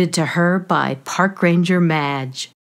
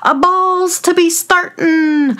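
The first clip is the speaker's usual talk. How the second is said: high, loud announcing shout; a high, ringing cry to start the party; loud yell; 11 semitones higher, 4 dB louder than usual. Acted. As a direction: call the ball open like a herald. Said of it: "someone yelled loudly".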